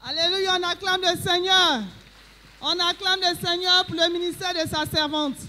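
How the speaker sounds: loud, high acclaim to the Lord; preaching. Acted. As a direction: rouse the church with praise. Worship leader acclaiming the Lord.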